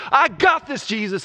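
high, loud and sure of himself; brash overconfident boast; cocky Peter. Retold or imitated. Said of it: "he's like".

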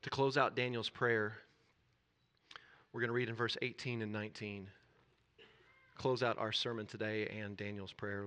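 ordinary talk, nothing marked

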